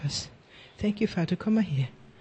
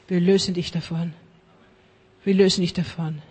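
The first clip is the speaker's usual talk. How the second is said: ordinary talk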